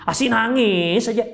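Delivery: mock annoyed. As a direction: imitate a husband fed up with his wife's crying. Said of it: "jengkel kita melihat istri".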